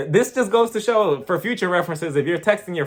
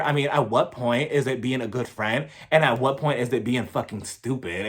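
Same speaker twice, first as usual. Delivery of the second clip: imitating Lisa; 6 semitones lower, 3 dB quieter than usual. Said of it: "she was just like".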